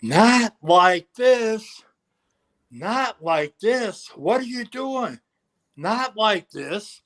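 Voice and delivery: high, slow, pleading wail; anguished pleading. Retold or imitated. Wrestler on the mat pleading with the referee, anguished. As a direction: beg it like your world is collapsing. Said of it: "and is yelling".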